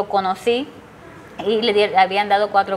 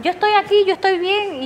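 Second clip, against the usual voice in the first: much higher, urgent reassuring son's voice; son reassuring mother; 10 semitones higher than usual. Retold or imitated. Son calming his mother at the door, higher reassurance. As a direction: pitch it way up, soothing a frantic mother. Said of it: "me dice".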